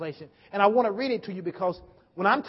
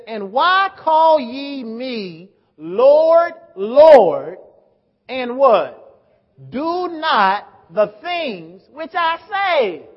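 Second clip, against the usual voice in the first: loud, very slow, drawn-out reading; declaimed scripture; 3 semitones higher, 10 dB louder, much slower than usual. Real, not acted. Preacher reading verse forty-six aloud, emphatic declamation. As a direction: proclaim the verse, stretching and pausing on key words.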